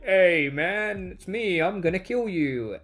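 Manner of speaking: lightly voiced laid-back villain; casual villain. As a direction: greet your victim like an old buddy. Anti-Monitor greets casually, voiced.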